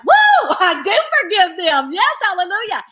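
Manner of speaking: high, loud whoop of praise; jubilant outburst. Retold or imitated. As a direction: burst out like a joyful hallelujah shout. Retells sudden joy at having forgiven.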